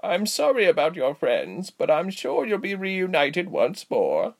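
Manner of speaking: put-on high, drawn-out old lady voice; consoling old woman. A narrator's reading. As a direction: reassure in a lilting granny voice.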